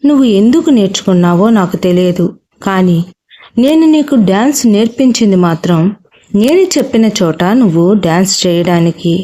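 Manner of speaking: plain reading voice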